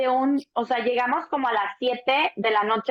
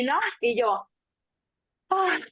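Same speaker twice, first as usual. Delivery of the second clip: ordinary talk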